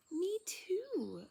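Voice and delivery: put-on excited little girl agreeing; eager agreement. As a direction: chime in happily. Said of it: "agrees Maisie".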